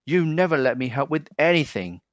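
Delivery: fast, rushed mild wail; a hint of a hurt wail; wailing duck. A narrator's reading. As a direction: blurt out the grievance fast. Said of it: "wailed the duck".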